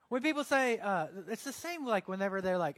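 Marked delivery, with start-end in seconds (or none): none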